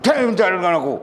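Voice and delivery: put-on a mother turning her child away, irritated; angry mother. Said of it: "Marah saja dia". (ibu marah, suara tinggi dan keras)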